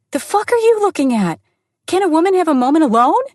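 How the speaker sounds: high-pitched, loud, fast outburst; furious shout. Acted. Woman screams at a stranger staring into her car, furious. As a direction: yell it in his face, fast and high. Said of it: "I cried out".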